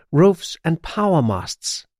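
ordinary delivery, nothing marked

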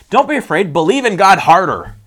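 loud, pushy religious urging; acted overzealous encouragement; mock exhortation. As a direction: press them to try harder. Mocking pushy religious advice.

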